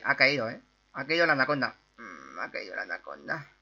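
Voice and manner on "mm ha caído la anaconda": much lower, softer voice; low-voiced repeat. Gamer echoing news of the fallen anaconda, low and soft.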